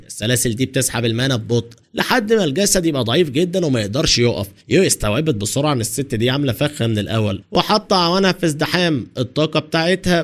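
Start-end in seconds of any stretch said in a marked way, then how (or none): none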